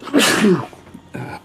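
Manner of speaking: loud, high real sneeze; sudden sneeze bursting out mid-sentence. Real, not acted. Vlogger interrupted while recapping objectives, sneezing. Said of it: "bless me".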